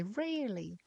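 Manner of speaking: stretched mock Irish-American twang, swooping down; imitating sister's accent. Teasing baby sister about her favorite word, mock Irish-American accent. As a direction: tease with a drawn-out Irish-American lilt. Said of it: "I bet you say".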